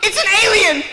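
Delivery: put-on loud, high-pitched child shriek; high mock-panicked kid voice; alarmed little girl. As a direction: squeal out like a startled small girl. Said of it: "this one girl who saw me".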